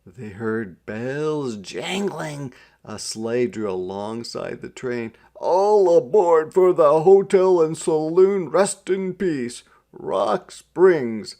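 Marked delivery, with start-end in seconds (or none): 5.3-11.4 loud, high call to the crowd; driver hollering. A narrator's reading. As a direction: bellow it out to the passengers. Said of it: "the driver hollered".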